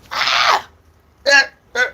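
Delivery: high, loud breathy cries of pain; pained groans. Retold or imitated. Boy hurting, crying out high and loud to mum.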